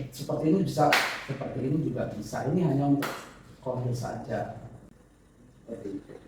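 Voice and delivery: ordinary talk, nothing marked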